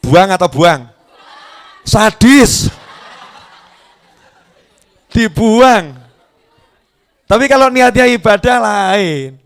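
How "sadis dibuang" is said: shouted reply. Real, not acted. Jamaah berteriak.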